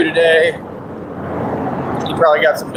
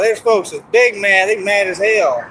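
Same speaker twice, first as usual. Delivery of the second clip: ordinary delivery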